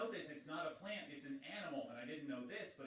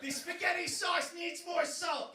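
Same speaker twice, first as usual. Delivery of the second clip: laughing shout; 7 dB louder, much slower than usual. Acted. Comic opening bit, laughing shout.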